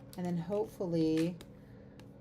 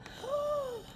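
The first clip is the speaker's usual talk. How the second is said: sharp gasp; 4 dB quieter than usual. Real, not acted. Realizes the mistake.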